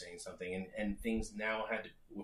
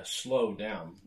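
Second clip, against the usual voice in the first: slowed demo; 2 semitones lower than usual. Shown as a demonstration. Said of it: "that means to".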